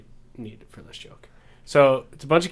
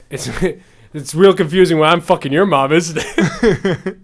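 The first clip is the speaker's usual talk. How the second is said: louder and higher, breaking into laughter; can't hold the laugh while delivering the last line; son's punchline to his dad, half laughing; 5 semitones higher, 7 dB louder than usual. Acted.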